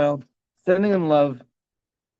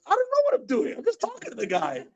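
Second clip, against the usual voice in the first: very high, helpless mock protest; retold bewildered protest; 11 semitones higher, 3 dB quieter than usual. Answering onlookers puzzled by his accent, bewildered protest. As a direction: plead innocence in a high-pitched voice.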